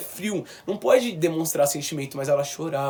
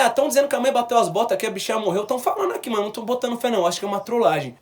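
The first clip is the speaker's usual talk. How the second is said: higher, quick, breezy dismissal; casual disbelief; 6 semitones higher, 3 dB louder, faster than usual. Retold or imitated. Son telling brother on the phone, breezy high dismissal. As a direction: tell it like it's surely a prank.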